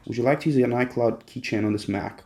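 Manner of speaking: ordinary talk, nothing marked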